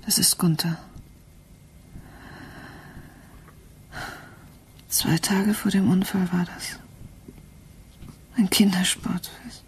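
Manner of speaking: soft, hushed, unvoiced grief; mother whispering about her dead son; grieving whisper. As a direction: barely voice it over the photo. Grieving mother looks at son's photo.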